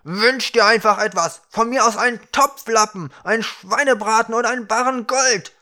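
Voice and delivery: high, loud, frantic demand; exasperated shouting. A narrator's reading. Ukra demanding any wish from the dwarf, exasperated yelling. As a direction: yell the options at him, fed up.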